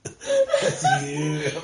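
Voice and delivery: words through a big laugh. Real, not acted. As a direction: squeal it while cracking up. Laughing at the joke.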